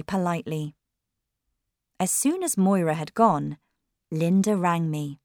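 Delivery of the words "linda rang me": usual speaking voice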